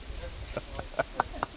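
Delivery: silent shaking laugh with no voice; real helpless laugh; voiceless laugh. Real, not acted. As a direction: laugh without making a sound. Laughing at the story.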